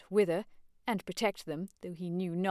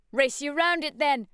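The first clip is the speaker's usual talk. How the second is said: high, loud playful challenge; youthful shouted dare; cried out; 8 semitones higher, 6 dB louder than usual. Acted. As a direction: call out a race dare. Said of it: "cried Freddy".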